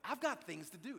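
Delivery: loud, high, exasperated retort; angry inner outburst. Retold or imitated. Preacher fuming inside at the lady's request, angry outburst. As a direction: burst out with acted indignation. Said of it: "this real intense feeling of anger".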